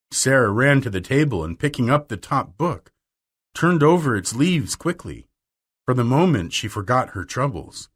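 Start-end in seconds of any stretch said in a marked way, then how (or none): none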